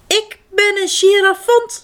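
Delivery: high, loud proud announcement. A narrator's reading. Girafant introduces itself, high and proud. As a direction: show off your new name. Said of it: "zegt het dier trots".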